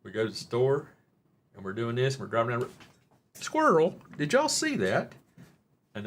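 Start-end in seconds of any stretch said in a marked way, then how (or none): 3.3-5.1 sudden high, loud squirrel yelp; high-pitched mock distraction; excited outburst. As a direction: blurt it out like a dog spotting a squirrel. Sudden distraction while driving joke.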